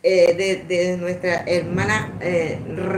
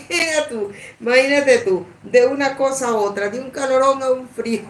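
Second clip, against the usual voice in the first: words spilling out through laughter, higher; hablando entre risas; 4 semitones higher than usual. Real, not acted. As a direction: laugh your way through it, a bit faster. Ríe contando el cambio de clima. (riéndose mientras habla)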